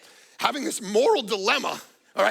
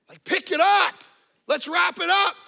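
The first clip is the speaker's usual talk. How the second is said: higher, louder hurry-up call; mock urging; 9 semitones higher, 7 dB louder than usual. Preacher mock-urging God to hurry, higher and louder call. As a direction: push him to hurry along.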